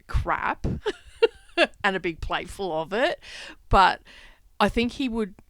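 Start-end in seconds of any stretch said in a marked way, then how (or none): 0.1-3.2 laughing scoff. Real, not acted. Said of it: "I just roll my eyes".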